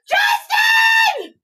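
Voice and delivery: high, loud laughing call; excited call. Real, not acted. Calling her husband over to see, laughing high shout. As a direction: call his name through delighted laughter.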